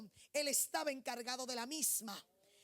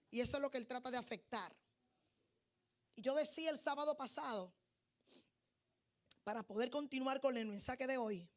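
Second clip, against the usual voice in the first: ordinary delivery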